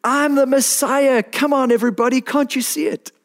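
imagine him shouting it from a stage; Jesus imagined as a showman announcing himself; mock stage proclamation. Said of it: "jumped up on the stage and said".

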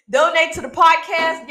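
playful loud appeal. Shown as a demonstration. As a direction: push the ask harder, half laughing. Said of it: "Yes, donate to the podcast".

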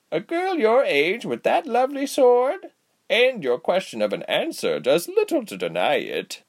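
put-on high, swooping old lady voice; knowing aged healer, lilting; knowing old woman. A narrator's reading. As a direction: tease out the truth like a shrewd granny.

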